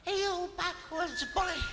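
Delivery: very high-pitched scolding critter; outraged little animal squeal; angry marten hissing. A narrator's reading. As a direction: tear into the bully in a tiny high voice. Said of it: "she hisses at the big bear".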